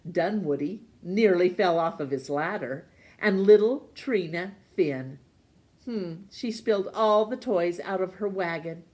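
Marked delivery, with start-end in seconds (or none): none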